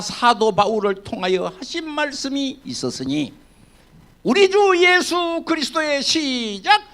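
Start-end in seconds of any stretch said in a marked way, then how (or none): 4.2-6.8 higher, louder proclamation; fervent scripture read. Acted. Pastor to congregation, reading scripture in a raised preaching voice. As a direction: declare the verse. Preacher reads verse with force.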